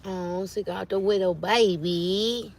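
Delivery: real adoring coo. Real, not acted. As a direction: gush tenderly, stretching the words. Cooing over someone's baby.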